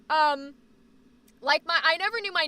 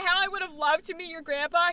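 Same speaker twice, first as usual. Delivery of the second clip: ordinary delivery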